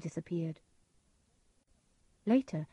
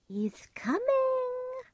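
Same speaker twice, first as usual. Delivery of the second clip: much higher, slow eerie whisper; witch whisper; much slower than usual. A narrator's reading. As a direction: hiss a warning like a witch. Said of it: "one of them said".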